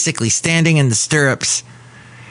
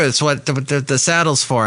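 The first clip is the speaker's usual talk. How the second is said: ordinary talk, nothing marked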